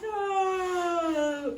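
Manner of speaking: long, drawn-out storybook yawn; open-mouthed yawn gliding slowly down; big yawn. A narrator's reading. Sleepy dwarf comes home, loud yawning. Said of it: "The sleepy dwarf yawns".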